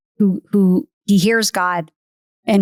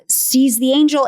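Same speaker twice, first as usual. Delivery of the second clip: normal talking voice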